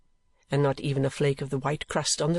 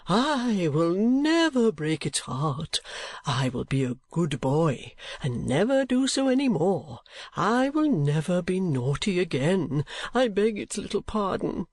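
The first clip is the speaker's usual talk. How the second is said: put-on high, slow baby-talk pleading; drawn-out, theatrical grovelling; wheedling foppish husband; 4 semitones higher than usual. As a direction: beg pardon like a penitent little boy. Voices penitent wheedling husband.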